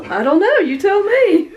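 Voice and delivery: patient snapping back at the doctor, laughing; exasperated retort. Retold or imitated.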